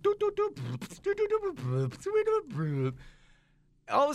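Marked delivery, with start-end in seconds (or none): 0.0-2.9 imitated clerk humming at the computer; mock computer humming. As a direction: hum like a clerk scanning his screen. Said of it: "he's like".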